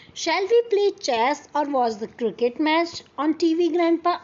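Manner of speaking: slow, careful read-aloud of the English text; story reading. Acted. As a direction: read each word out slowly for the class. (धीमी आवाज़ में कहानी पढ़ना)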